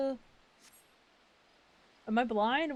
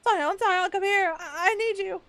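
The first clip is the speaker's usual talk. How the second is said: calling out; 8 semitones higher, 8 dB louder than usual. Acted. NPC calling for help.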